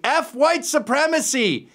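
very high, drawn-out mock scream. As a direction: imitate screaming protesters, pitch way up.

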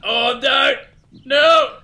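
loud, high protest; real dismayed yell; alarmed shout. Real, not acted. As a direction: cry out as it goes wrong. About to lose.

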